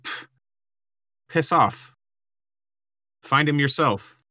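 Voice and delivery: scoffing, brushing them off; dismissive soldier. Pretend. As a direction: wave them off with contempt. Dismissive soldier NPC retort.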